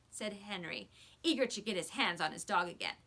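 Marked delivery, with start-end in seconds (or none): none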